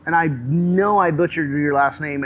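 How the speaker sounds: usual speaking voice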